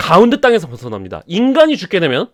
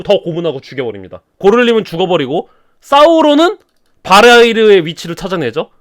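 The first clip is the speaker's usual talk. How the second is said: ordinary talk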